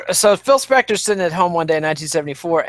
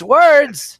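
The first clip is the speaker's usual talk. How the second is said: Words! blurted high and loud in a silly voice; mock exclaim; 10 semitones higher, 6 dB louder than usual. Real, not acted. Host mocking his own pronouncing, loud and high. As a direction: yell Words! as a self-mocking joke. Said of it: "I can't pronounce words".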